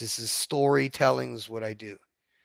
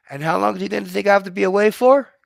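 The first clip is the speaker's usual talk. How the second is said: quick, louder, rising indignant question; fast indignant retort; 5 semitones higher, 7 dB louder, faster than usual. Retold or imitated. Wife snapping back at her husband, quick and indignant. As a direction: rattle it off, higher and louder, fed up.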